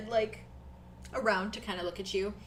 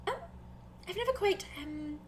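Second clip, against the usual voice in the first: soft, hesitant character voice with starts higher; Arthros, unsure; 3 semitones higher, 11 dB quieter than usual. Acted. Arthros admits she's new to nail polish, hesitant. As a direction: falter politely over the unfamiliar idea. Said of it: "looks at you and she's like".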